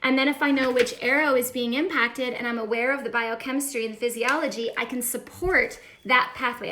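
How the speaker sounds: ordinary talk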